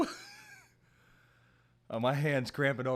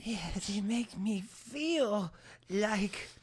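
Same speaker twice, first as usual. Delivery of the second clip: slow, seductive, higher voice; sultry voice; 8 semitones higher, 4 dB louder, slower than usual. Pretend. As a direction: purr it out like a tease. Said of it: "I don't like that. What was that?".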